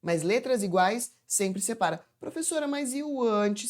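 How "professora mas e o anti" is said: higher-pitched imitation of an asking student; student's question. Pupils always asking the teacher about anti, imitated higher. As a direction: mimic a pupil piping up with a doubt. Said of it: "Sempre me perguntam isso".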